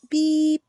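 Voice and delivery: high, bright little horn beep; truck beep. A narrator's reading. Little truck greeting a toad, bright beep. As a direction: toot like a friendly horn. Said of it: "Blue said".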